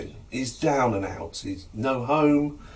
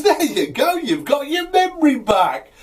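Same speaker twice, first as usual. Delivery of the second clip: very high, loud jubilation; triumphant announcement; 6 dB louder than usual. Acted. Healer declares the patient cured, loud high triumph. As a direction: crow over a trick that worked.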